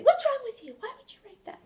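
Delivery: the angry mama-bear yell she held back; mock angry yell. Angry mother yelling at her son, a mock furious yell. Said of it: "I wanted to scream and yell".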